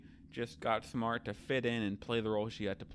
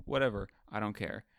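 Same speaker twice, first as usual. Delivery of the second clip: ordinary talk, nothing marked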